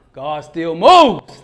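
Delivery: very slow, loud, every word weighted; bold proclamation. Real, not acted. Preacher proclaiming on stage, slow, loud and bold. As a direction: declare it like a sermon climax.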